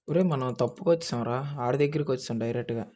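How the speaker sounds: normal talking voice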